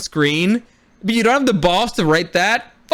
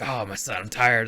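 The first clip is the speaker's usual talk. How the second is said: weary mock father; 8 semitones lower, 7 dB quieter than usual. Father voiced in retold scene.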